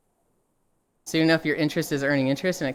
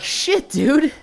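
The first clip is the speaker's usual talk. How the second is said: dismayed curse; 9 semitones higher than usual. Retold or imitated. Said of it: "And it's like".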